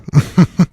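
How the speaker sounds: amused little laugh; short chuckle. Real, not acted. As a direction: chuckle briefly at your own story. Laughs at his own story.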